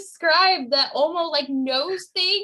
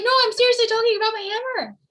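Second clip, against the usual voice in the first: excited insistence; 8 semitones higher, 4 dB louder, faster than usual. Retold or imitated. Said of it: "he's, like".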